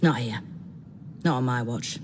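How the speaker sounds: ordinary talk, nothing marked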